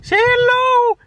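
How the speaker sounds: high sing-song voice to a pet. Real, not acted. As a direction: coo it up high like to a puppy. Cooing to dog.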